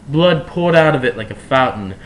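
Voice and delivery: plain reading voice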